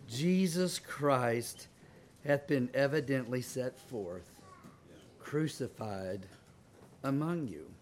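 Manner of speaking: scripture reading. Acted. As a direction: read it like holy text before the congregation. Reads Galatians aloud solemnly.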